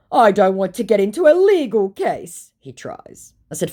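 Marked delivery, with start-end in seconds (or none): 0.1-2.5 high, loud imitation of his protest; exaggerated put-on suitor voice; mock protest. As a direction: mimic him pleading his case. Mocking retelling of his messages.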